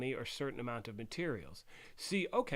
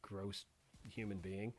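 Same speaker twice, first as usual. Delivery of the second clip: hoarse after choking; 4 semitones lower, 6 dB quieter than usual. Real, not acted. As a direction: talk through a throat still sore from coughing. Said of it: "I almost choked on drinking tea".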